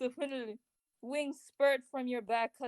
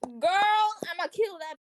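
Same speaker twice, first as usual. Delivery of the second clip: loud, high sassy outburst; hammed-up diva threat; 8 semitones higher, 10 dB louder than usual. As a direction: belt it out with exaggerated sass. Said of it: "gives a sassy lip smack".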